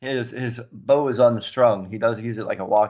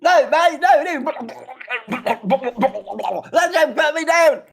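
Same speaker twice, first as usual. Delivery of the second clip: much higher, louder, laughing protest; high panicked shout; 14 semitones higher, 5 dB louder than usual. Acted. Character bathed against his will.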